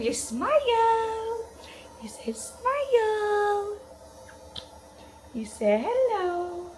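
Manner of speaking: dog voice. Real, not acted. Owner prompting her puppy to say hello in dog voice. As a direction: coax the puppy to greet the camera. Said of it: "talking a dog voice all week".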